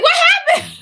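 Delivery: high, loud startled cry; alarmed shout. Retold or imitated. Brother runs outside, shouts high and alarmed. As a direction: bursts out asking in alarm.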